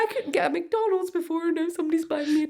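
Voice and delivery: high, fast mock gush; gushing disbelief. Telling her friend about the date, high fast mock gush. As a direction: gush it out, half laughing at yourself. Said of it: "I'm like".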